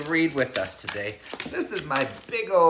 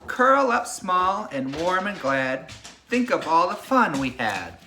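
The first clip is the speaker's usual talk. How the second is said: put-on reading to children. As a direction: lull the class like a bedtime story. Reads picture book to class.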